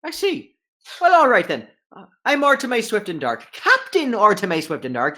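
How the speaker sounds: high, loud brisk gnome captain. Acted. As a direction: haggle as a cheerful gnome captain.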